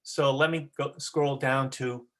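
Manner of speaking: ordinary talk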